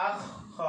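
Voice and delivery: আখ খ, kh ending one syllable and starting the next; doubled kh demo. Shown as a demonstration. Teacher shows kha with tashdid, doubled-kh demo. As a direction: draw out the kh between আখ and খ. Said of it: "তাসদিদ দিয়ে উচ্চারণ করি".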